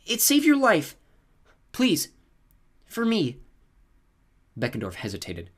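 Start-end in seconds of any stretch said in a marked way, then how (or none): 0.0-3.4 coaxing plea that trails off; pleading. A narrator's reading. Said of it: "Selena pleaded".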